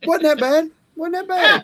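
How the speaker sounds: high, loud mock question; teasing. Real, not acted. Teasing the host about last night's bad stream, mock disbelief. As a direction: tease her playfully. Teasing echo of her remark.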